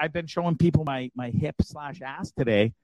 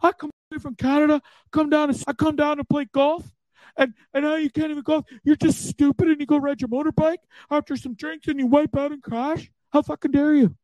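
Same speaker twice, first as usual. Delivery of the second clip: high-pitched mock scolding from an aggrieved friend; comically indignant, squeaky telling-off; upset buddy; 14 semitones higher than usual. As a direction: imitate a friend whining in outrage. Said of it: "He's so upset with me".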